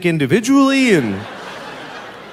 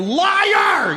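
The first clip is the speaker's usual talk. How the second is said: mock yell; 13 semitones higher than usual. Mock rage at the bubble.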